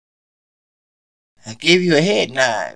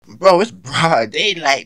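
usual speaking voice

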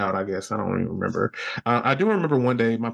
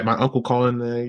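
ordinary talk

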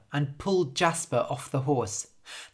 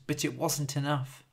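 plain reading voice

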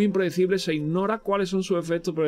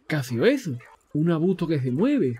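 normal talking voice

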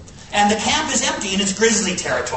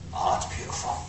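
awed hush; 10 dB quieter than usual. Real, not acted. Remembering the Montana view.

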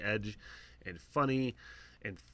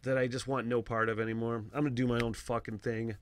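normal talking voice

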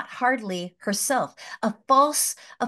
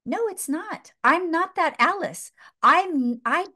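raised, pleading refusal; Alice refusing the sword, pitched up; high protesting Alice; 7 semitones higher, slower than usual. Retold or imitated. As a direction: push back high and urgent.

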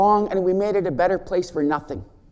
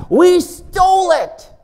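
louder and higher, slowed right down; emphatic slow punchline; 8 semitones higher, 7 dB louder, slower than usual. Real, not acted. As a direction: land it hard: we stole it. Punchline about borrowed word.

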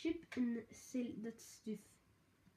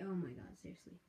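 ordinary delivery

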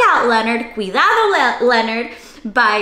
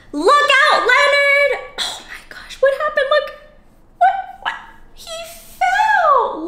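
mock alarm; 13 semitones higher than usual. Host reacting to Leonard falling, mock alarm. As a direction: gasp and warn playfully.

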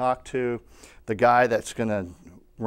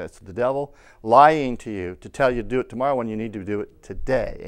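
ordinary talk, nothing marked

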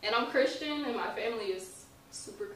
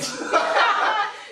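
high loud burst of laughter; nervous laugh; 13 semitones higher, 13 dB louder than usual. Real, not acted. Guest hiding emotion with a loud nervous laugh. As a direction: laugh off the nerves.

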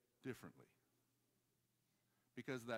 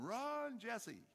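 high, drawn-out mock yell; retold yell; 11 semitones higher, 4 dB louder than usual. Brother running off warns Jesse, imitated high yell. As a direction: call out high, as if to someone left behind. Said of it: "he yells back at him".

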